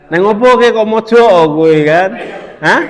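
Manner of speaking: half-acted nosy question, rising at the end; lightly voiced Javanese neighbour; curious Javanese friend. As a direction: play a friend asking why you sit in the corner. Teacher acts a Javanese friend asking.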